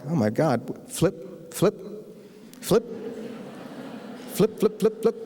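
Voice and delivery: flipping pages in a high, airy mutter; mock bored reader. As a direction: skim the pages, bored and impatient. Flipping past dumped research pages.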